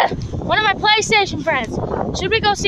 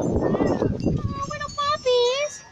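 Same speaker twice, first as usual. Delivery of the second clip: high, very slow puppy coo; 9 semitones higher, 8 dB quieter, much slower than usual. Real, not acted. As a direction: stretch it out, adoring. Cooing at a puppy.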